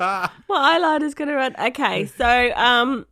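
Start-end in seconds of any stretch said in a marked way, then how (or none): 0.0-1.5 fast, laughing till crying. Real, not acted. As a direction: talk through tears of laughter. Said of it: "I'm crying".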